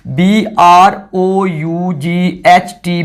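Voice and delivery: "B R O यू जी H T" spelled letter by letter, very slowly; slow spelling out. Shown as a demonstration. Teacher spelling the new ending for students, very slow. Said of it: "उसकी जगह पे मैं क्या लगाऊंगा".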